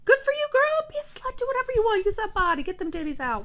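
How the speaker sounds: high, squealing hype for a friend; excited cheering. Real, not acted. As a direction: cheer her on like a best friend.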